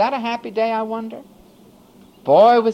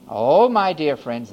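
usual speaking voice

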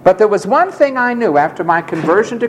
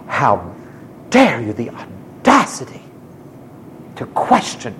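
low, hushed, affronted; insulted teachers; 5 semitones lower, 3 dB quieter than usual. Retold or imitated. Offended teachers scolding the questioning student, hushed and affronted. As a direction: sound scandalised at being questioned. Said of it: "they were insulted".

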